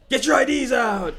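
loud, high mock shout; loud, hammed-up order; rude bartender's yell.

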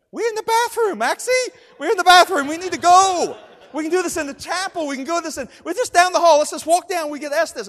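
high, loud, insistent plea to leave; urgent protest. Retold or imitated. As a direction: plead urgently to move elsewhere. Urgent protest to Maxie in bathroom.

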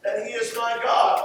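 ordinary delivery

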